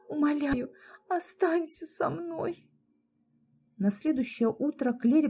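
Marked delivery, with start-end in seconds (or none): 0.1-2.7 softer, higher, slow begging; whispered plea. A narrator's reading. Lera begging Vlad in the dark, soft. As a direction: beg him softly to stay. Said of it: "шептала она в темноту".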